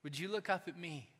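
soft, quiet, gently coaxing; a hint of a loving father calling; gentle call from God. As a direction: coax them tenderly to look up. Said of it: "Elroy looks at you and says".

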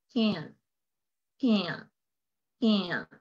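"Can" repeated with an effortless æ vowel from the throat; teacher drilling "can" slowly for the class; relaxed can. Shown as a demonstration. "can" with a loose a-e sound, no strain. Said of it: "Algo así es como una A E".

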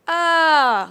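"ah" swooping high then down. Shown as a demonstration. Teacher shows the textbook falling tone, arched loud "ah". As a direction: draw "ah" up high and let it fall. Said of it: "like, up and down".

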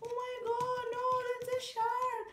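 high, hammed-up fright; scared little fish. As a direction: squeal it like a tiny terrified fish. Little fish sees the shark.

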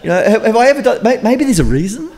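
high, pleading donkey voice; talking donkey. Acted. Donkey defending itself to Balaam, high and pleading. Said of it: "And the donkey says".

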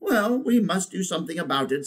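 high elephant character voice; decisive she-elephant. Acted. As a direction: take charge as the elephant. Elephant takes charge in story.

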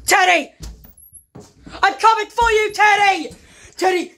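calling out. Acted. Searching, calling for Teddy.

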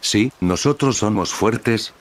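usual speaking voice

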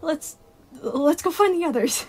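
nervously chipper; flustered cheer. Acted. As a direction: stumble into a high, upbeat suggestion. Flustered Sun redirects the child.

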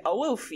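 AOIFE misread as "oh-OO-fee"; spelling misreading. Shown as a demonstration. Co-host agreeing the name looks odd, misread as spelled. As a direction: sound out Aoife wrongly, ending in fee. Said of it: "It looks like".

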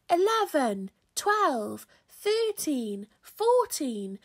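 put-on children calling numbers in turn; high, punchy call on each number; shouted counting. A narrator's reading. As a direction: count off aloud like a class roll-call. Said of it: "took turns to shout out a number".

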